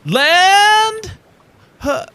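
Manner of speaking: very high, loud cry that falls apart; self-conscious, aborted sailor's holler; botched lookout call. Acted. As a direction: attempt the big call, then falter. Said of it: "I'm just in my head about it now".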